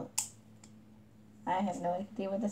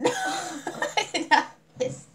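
much higher, very slow through laughter; laughing denial; much slower than usual. Real, not acted. Denying the accusation to her friend, laughing. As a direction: deny it while cracking up.

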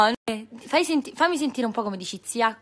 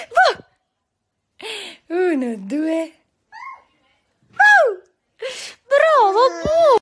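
high, loud, slow cheer; high loud slow peekaboo cheer; 10 semitones higher, 5 dB louder, slower than usual. Real, not acted. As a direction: cheer the little one. Peekaboo game with toddler.

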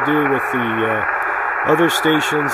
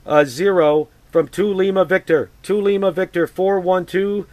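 radio call; 6 semitones higher than usual. Acted. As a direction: clear ham radio call voice. Ham radio call on air.

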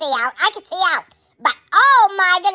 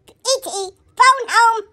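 slow, high, croaky alien plea; 5 semitones higher, much slower than usual. Shown as a demonstration. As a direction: drag out "phone home" like a lost alien. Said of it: "I'm E T".

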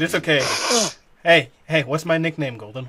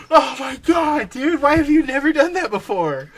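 high-pitched, laughing amazement; real giggly, raised-pitch astonishment; amused disbelief; 8 semitones higher than usual. Real, not acted. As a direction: marvel while cracking up. Cracking up at friend's bit.

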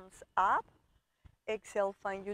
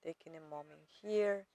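soft, lowered, unhurried meditation voice; guided meditation; 3 semitones lower, 3 dB quieter than usual. Real, not acted. Yoga teacher guiding students to arrive to their body, calm meditation voice. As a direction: settle the listener gently, with pauses between phrases.

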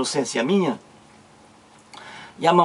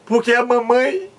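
put-on crying child; 8 semitones higher, slower than usual. Boy tells father mother gave the dog away, mock crying. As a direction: wail it slowly through exaggerated sobs. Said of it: "eu tô chorando".

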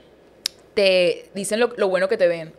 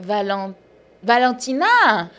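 Valentina as a slow French "Valon-tina", last syllable higher; French mispronunciation; 4 semitones higher, 4 dB louder, much slower than usual. Retold or imitated. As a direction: struggle through "Valentina" in a mock French accent. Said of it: "ellos no pueden decir Valentina".

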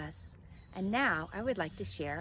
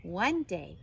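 ordinary delivery, nothing marked

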